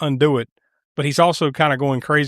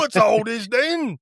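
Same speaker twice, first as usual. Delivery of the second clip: a Cockney bobby, pitched much higher; mock Cockney cop; 7 semitones higher, slower than usual. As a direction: play the Cockney policeman. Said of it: "It's like a Cockney accent".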